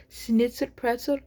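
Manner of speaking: amateur German accent; attempted German accent. Shown as a demonstration. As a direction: have a go at sounding German. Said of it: "I try to just do an accent a little".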